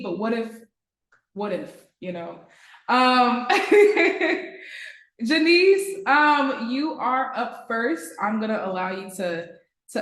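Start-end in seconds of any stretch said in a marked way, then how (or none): 3.5-4.9 short high laugh. Real, not acted. Before calling the first student, a laugh. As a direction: let a laugh out.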